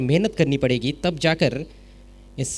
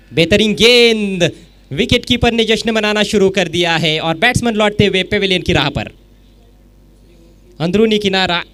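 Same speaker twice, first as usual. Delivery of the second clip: much higher, much louder wicket call; excited cricket commentary; 6 semitones higher, 8 dB louder than usual. Real, not acted. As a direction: erupt as the stumps fall. Wicket falls, commentator erupts. (जोशीली cricket commentary)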